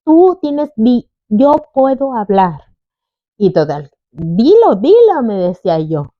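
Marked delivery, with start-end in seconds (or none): 0.0-2.6 high, slow "yo puedo hablar" ordered; mock command. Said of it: "pero me lo ordenaba". 4.1-5.2 rising, urging "Dilo, dilo"; mock insistent urging; insistent command. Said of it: "pero me lo ordenaba".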